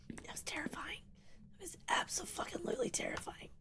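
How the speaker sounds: high, breath-only whisper after a fright; shaken whisper. Real, not acted. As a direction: squeak it out in a tiny terrified whisper. Just got scared in game.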